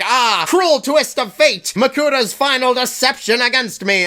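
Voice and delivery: mock-tragic plant villain; hammed-up lament. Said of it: "still has the gall to be like".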